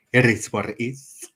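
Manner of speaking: lightly voiced tough-guy catchphrase; mock catchphrase. Mimicking the tough streamer's catchphrase lightly. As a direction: drop the famous line half-serious. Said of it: "I'm nearly saying".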